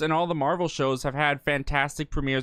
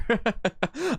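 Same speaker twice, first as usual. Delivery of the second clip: laugh; 8 semitones higher than usual. Real, not acted. Laughing at own Bob Iger joke.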